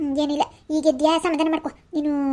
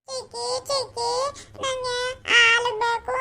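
put-on much higher, small whiny child asking; little child voice; 10 semitones higher than usual. Little girl whining to her aunt for Boost milk, child voice. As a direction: beg like a tiny kid for milk.

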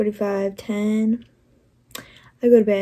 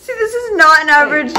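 laughing; 5 semitones higher, 7 dB louder, faster than usual. Real, not acted.

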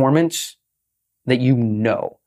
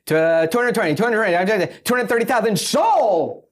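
rising auctioneer patter, pitch climbing; 7 semitones higher than usual. Shown as a demonstration. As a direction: rattle off bids like an auctioneer. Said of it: "you sound like an auctioneer".

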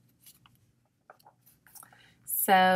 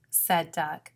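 ordinary delivery

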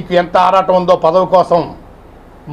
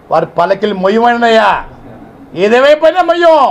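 much higher and louder final refusal; defiant shout; 6 semitones higher, 5 dB louder than usual. Real, not acted. Leader refusing to carry the party's palanquin, shouting defiantly. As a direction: hurl the refusal at him.

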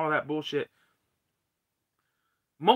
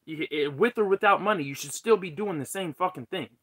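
ordinary talk

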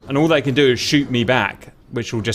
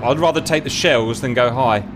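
ordinary talk, nothing marked

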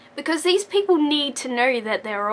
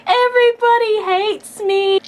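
mock self-pity; 9 semitones higher, 6 dB louder than usual. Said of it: "and I'm just like".

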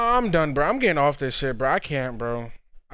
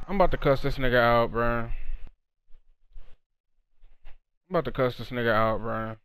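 fading muttered threat; 9 semitones lower, 6 dB quieter, faster than usual. Real, not acted. Muttering threat, growing quieter.

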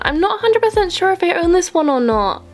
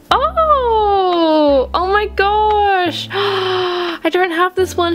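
high, slow delighted squeal of surprise; genuinely overjoyed shriek; excited squeal; 5 semitones higher, much slower than usual. Real, not acted. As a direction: gasp and squeal with real joy. Finds a rare pet she lacks.

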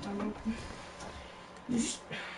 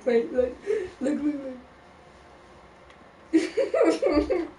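laughing; 4 semitones higher, much slower than usual. Real, not acted. Laughing while showing the phone.

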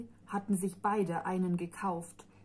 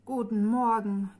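grumbling; 2 semitones higher, 6 dB louder than usual. A narrator's reading. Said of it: "grummelte sie".

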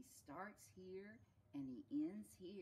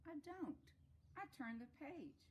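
high-pitched storybook reply; reply in a raised, lightly acted voice; answering character; 10 semitones higher than usual.

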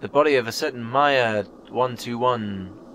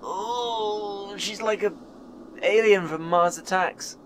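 disgusted; 8 semitones higher, slower than usual. Real, not acted. Disgusted by the character model.

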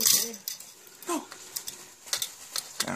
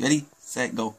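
normal talking voice

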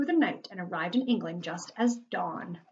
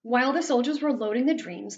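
usual speaking voice

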